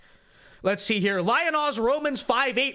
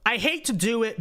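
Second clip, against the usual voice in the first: normal talking voice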